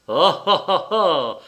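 loud mocking "ah ah" cackle; sardonic yelping laugh. A narrator's reading. The little one jeers at the tall one, sardonic laugh. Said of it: "glapissant avec un rire sardonique".

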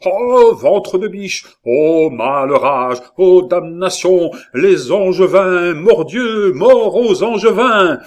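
high, loud, drawn-out curses; fierce war cry. A narrator's reading. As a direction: roar each oath in wild fury. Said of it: "battit les murs en répétant avec des yeux farouches". (imprécations hurlées, lentes et farouches)

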